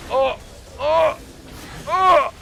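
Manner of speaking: building from tense to a loud high yell; excited yelling. Real, not acted. Tense fight, game may be won.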